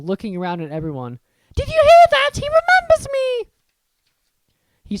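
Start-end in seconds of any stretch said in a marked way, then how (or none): 1.6-3.5 very high, loud delighted cry; cried out. Acted. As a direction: squeal with joy. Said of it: "cried Daedalus Diggle".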